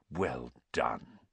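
very soft, low approval; quiet praise. A narrator's reading. Dumbledore beams at Harry and Hermione, quiet. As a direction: praise them gently, almost secretly.

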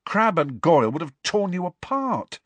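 high-pitched, gasped protest. A narrator's reading. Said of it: "Harry gasped".

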